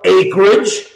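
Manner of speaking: ordinary talk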